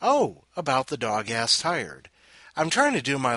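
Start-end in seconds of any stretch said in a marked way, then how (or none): none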